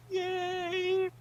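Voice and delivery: soft high celebratory yay; high little cheer. Acted. Reader cheers for the hero, soft high yay. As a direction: squeal a tiny yay.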